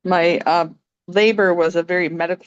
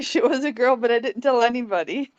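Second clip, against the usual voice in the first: normal talking voice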